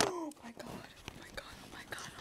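breathless whispered panic; panicked whisper. Real, not acted. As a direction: hush it out in sudden alarm. Panicked whisper as camera falls.

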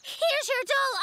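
high, winded calling with an audible puff; out of breath. Acted. Rushing up to Franny with the doll, out of breath. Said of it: "I'm a little out of breath".

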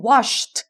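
"washed" ending in a released t after sh; puffed final t. Shown as a demonstration. Teacher shows the ed rule, puffed final t. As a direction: puff a t straight after the sh in "washed". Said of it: "เราจะพ่นลมตามออกไปทันที ก็คือ th".

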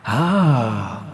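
drawn-out falling "ah" cry; long held "ah". A narrator's reading. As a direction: let out a sustained "aaah". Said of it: "dit une voix". (long « ah » tenu)

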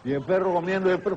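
normal talking voice

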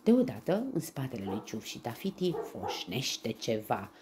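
plain reading voice

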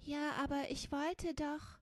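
high, timid protest; stammered objection. A narrator's reading. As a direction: meekly try to object. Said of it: "stammelte Fingerspitzchen".

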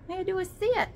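put-on high little baby-talk voice for the cat. Voicing her cat asking to sit, tiny high voice. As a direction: speak for the kitty in a tiny high voice.

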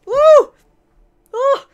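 short, very high cries to let emotion out; whooping exhale of overwhelmed feeling; high loud whoop. Real, not acted. Venting emotion after acted scene.

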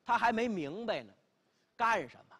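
ordinary delivery

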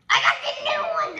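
high, loud, fast announcement; excited shout. Real, not acted. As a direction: blurt it out excitedly. Announcing spin result.

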